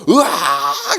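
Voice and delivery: long drawn-out "aargh"; exaggerated agonised cry; mock yell of pain. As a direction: imitate a patient howling in pain. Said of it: "고함 지던".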